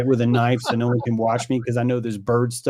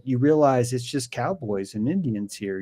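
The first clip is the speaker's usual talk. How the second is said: ordinary talk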